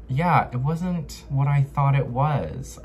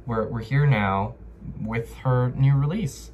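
ordinary delivery

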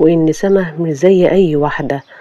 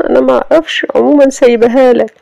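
put-on young woman's voice; 8 semitones higher, 4 dB louder, faster than usual. Sama answers Sameh coldly.

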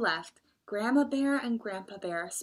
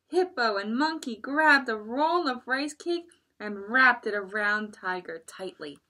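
ordinary delivery

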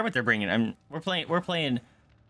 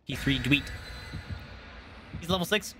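ordinary delivery

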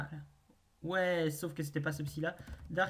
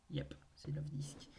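breathy whisper, no voice; 10 dB quieter than usual. Real, not acted. Streamer whispering the name to viewers, hushed. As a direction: whisper it like a secret.

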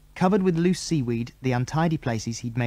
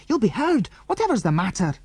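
angry; 9 semitones higher than usual. A narrator's reading. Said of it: "said Andy angrily".

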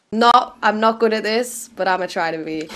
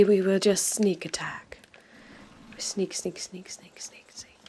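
whispered and fading softer; tiptoeing whisper, mock stealth; sneaky whisper; 5 semitones lower, 10 dB quieter than usual. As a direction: creep in with a hushed, playful whisper. Sneaking up on enemies in game.

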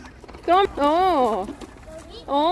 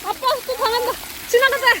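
high, playful cry of defeat; excited playful yelp; 8 semitones higher than usual. Real, not acted. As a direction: ham up being hit again. Dad getting splashed again.

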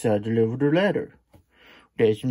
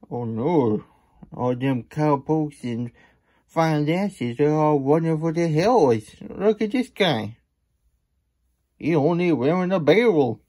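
townsfolk voice; 5 semitones higher than usual. Acted.

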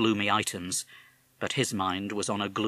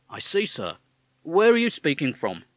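policeman; 6 semitones higher, 6 dB louder than usual. Acted. Policeman questions caller.

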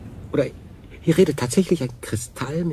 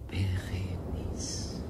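slow, breathed whisper; softly bestow the name; tender naming; 6 semitones lower, 10 dB quieter, much slower than usual. Acted. Gives the crystal a name. (zärtlich geflüstert)

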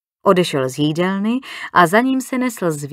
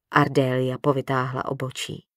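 ordinary delivery, nothing marked

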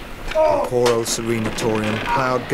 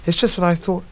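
high rabbit voice, wondering; 6 semitones higher than usual. Acted. Peter the rabbit telling Dobbin what he saw, higher. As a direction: say it as a puzzled rabbit. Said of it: "replied the rabbit".

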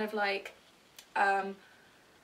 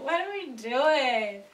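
long-drawn exasperated wail; mock despair; 3 semitones higher, 6 dB louder, much slower than usual. Real, not acted. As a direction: wail it slowly at your own silliness. Mock despair at own rambling.

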